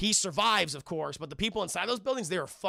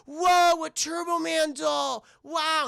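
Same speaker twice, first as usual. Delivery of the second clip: high, loud, drawn-out awe; imitating a delighted kid; 9 semitones higher, 6 dB louder, much slower than usual. Boy gets the doll he wanted, mock-delighted. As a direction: gush like an amazed little boy. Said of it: "he's all fucking happy".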